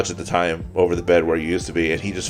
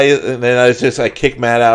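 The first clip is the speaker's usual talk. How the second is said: normal talking voice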